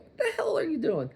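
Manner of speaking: high, indignant mock cry; imagined stranger snapping back, hammed-up; mock outraged retort. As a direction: play the slapped stranger, offended. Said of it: "be like".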